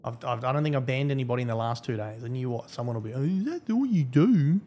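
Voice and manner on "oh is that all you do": slow, louder mocking put-on accent; mock the complainer, slow and higher; drawn-out imitation of a griping cheater; mimicked cheater. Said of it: "That's how they talk".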